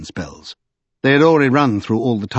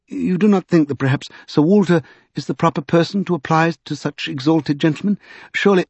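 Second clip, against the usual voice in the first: higher, hesitant polite suggestion; tentative objection; 6 semitones higher than usual. Acted. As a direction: venture it delicately, pleading a little. Secundus timidly objects, is cut off.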